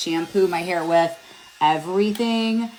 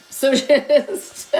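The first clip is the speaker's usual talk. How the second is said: breaks into a light laugh mid-word; chuckling through the word; 6 semitones higher, 5 dB louder than usual. Real, not acted. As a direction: laugh it off at your own joke. Laughs at own tombstone joke.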